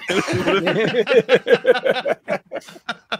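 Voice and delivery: high burst of loud laughter. Real, not acted. Laughs after joke.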